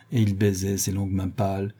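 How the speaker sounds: usual speaking voice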